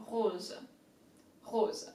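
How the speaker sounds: rose with a guttural French R; throaty French R. Shown as a demonstration. Teacher showing the French R in rose. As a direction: growl the R in rose from deep down. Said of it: "R has come from deep down, like you're upset".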